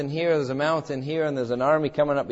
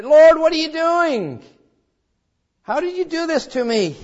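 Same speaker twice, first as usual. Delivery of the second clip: desperate complaint, much higher and louder; anguished cry; 12 semitones higher, 5 dB louder than usual. Retold or imitated. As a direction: cry out to the Lord in distress. Pastor voices his own complaint.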